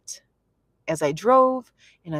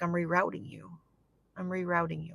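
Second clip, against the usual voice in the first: soft, even voice of God; understated, softly spoken reassurance; calm divine rerouting; 9 dB quieter, faster than usual. Retold or imitated. Voicing the Lord's calm redirection.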